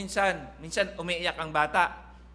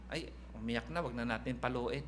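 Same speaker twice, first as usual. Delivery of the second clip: softer, lower, pitying mother; soft pitying parent; 6 semitones lower, 8 dB quieter than usual. Retold or imitated. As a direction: mimic a soft-hearted mom giving in. Voices an indulgent parent.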